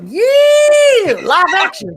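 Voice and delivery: high, loud excited shout of live action; hyped cheer. Acted. Friend cheering about fireworks, in a high excited shout. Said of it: "Just be like".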